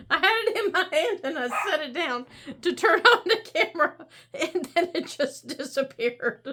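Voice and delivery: fast, giggling explanation; laughing. Real, not acted. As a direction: laugh while explaining the mishap. Laughing at own mishap.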